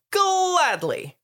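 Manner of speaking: high, loud, drawn-out reply; mock-eager retort. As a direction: stretch it out high with exaggerated willingness. Harry's retort acted out in retelling.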